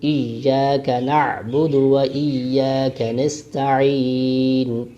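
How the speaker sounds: slowed, chanted إِيَّاكَ نَعْبُدُ joined smoothly; joined recitation. Shown as a demonstration. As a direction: recite the whole verse in one flowing line. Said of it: "میں نے جوڑ بھی کر دیے".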